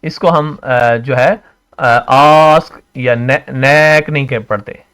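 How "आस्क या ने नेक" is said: आस्क and नेक with long drawn-out vowels; prolonged आ in आस्क, prolonged ए in नेक; wrongly stretched. Shown as a demonstration. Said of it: "stretch नहीं करते हैं".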